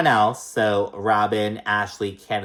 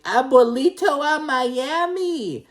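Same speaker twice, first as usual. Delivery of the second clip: much higher, slow sing-song mock greeting; mock Giselle greeting; much slower than usual. Recapper mocking Giselle's arrival greeting, high and slow. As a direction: stretch out "Abuelito a Miami" high and slow, teasing. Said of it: "and she's like".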